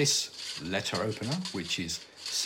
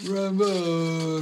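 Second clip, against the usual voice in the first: loud hoarse cockney call; street cry; 8 semitones higher, 6 dB louder than usual. Acted. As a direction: bellow it like a street trader.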